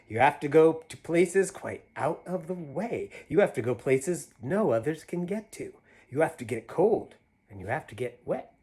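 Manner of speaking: put-on reading to children. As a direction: read it big and playful for a child. Reads picture book aloud.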